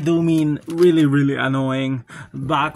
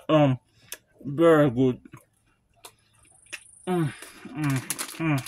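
talking around a snack; biting crunchy cheese puffs; mouth full, chewing; 4 semitones lower, 5 dB quieter than usual. Real, not acted. Seen 2: Holds orange puff at lips, biting and chewing while speaking.

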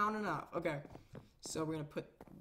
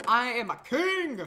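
slow and louder, a proud declaration; mock royal boast; 5 semitones higher, 9 dB louder, slower than usual. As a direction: proclaim it like a pompous king. Boasting about king size bed.

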